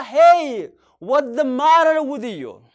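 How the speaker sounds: loud, slow, Hey called out; loud concerned call. Shown as a demonstration. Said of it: "maal ta'e gaafatta?".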